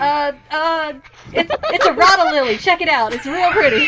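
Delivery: loud, high southern florist, close to laughing; southern sales patter over laughter; southern accent. Acted. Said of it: "doing a southern accent for these characters".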